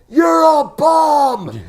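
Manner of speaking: loud, high heckler shout with drawn-out words; yelling heckler. Retold or imitated. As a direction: yell abuse at the players from the stands. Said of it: "he's yelling at".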